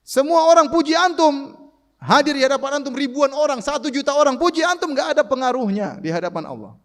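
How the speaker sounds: high, loud fervent preaching; rising fervent sermon climax; preaching at full force. Real, not acted. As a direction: drive the point home passionately. Preacher emphatic peak.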